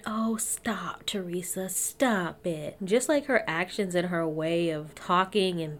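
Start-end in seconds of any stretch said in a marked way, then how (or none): 0.0-2.8 soft, whispery "oh stop it"; play-scold under your breath; half-whispered, feigned protest; hushed mock protest. Mock protest at gifts she described.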